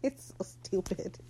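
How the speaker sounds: breathless whisper after laughing; whispered through laughter. Real, not acted. Vlogger recovering from laughing fit, whispering. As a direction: barely voice it, still giggling. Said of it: "I'm sorry".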